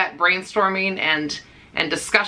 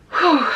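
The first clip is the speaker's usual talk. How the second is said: sigh of relief; 9 semitones higher, 4 dB louder than usual. Real, not acted. Tense talk about cancer.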